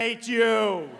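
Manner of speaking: put-on loud, sulky little boy shout; kid's angry yell. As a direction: spit it at the coach. Boy yells at coach.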